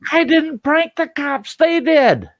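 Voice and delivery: high, slow wail of innocence; panicked high-pitched denial; indignant protest. Acted. Protests to cop in the story.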